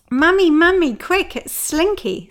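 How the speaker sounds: put-on high, loud urgent call of a child; a daughter shouting for her mum, then slowing on the last words; retold child calling out. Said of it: "my daughter screamed out".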